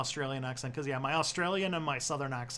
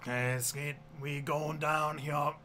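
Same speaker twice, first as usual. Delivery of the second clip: slow, tentative Cajun accent; halting Cajun attempt; 3 dB quieter, slower than usual. Shown as a demonstration. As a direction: try out a Cajun drawl. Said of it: "Someone wants a Cajun accent".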